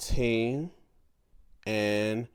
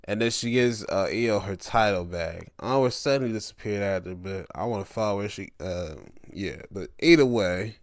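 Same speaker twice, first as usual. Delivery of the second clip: normal talking voice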